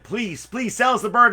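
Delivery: pleading crowd. Acted. Crowd begging the owner.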